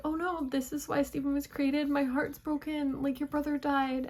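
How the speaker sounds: a touch of pleading sympathy, pitched high; heartbroken reaction. Retold or imitated. Viewer reacting to Stephen's sad origin, mildly heartbroken.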